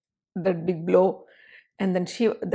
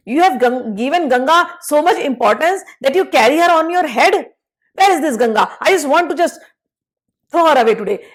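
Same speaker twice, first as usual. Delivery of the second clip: high, louder jealous scolding; jealous rant; 6 semitones higher, 5 dB louder than usual. Acted. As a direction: scold him, fed up with Ganga. Angry Lalita rants at Shiva.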